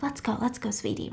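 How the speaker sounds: soft, under-the-breath urging; hushed coaxing. Retold or imitated. Kira tugs Will's arm to leave, hushed coaxing. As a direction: coax a partner out discreetly.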